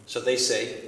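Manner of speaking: ordinary talk, nothing marked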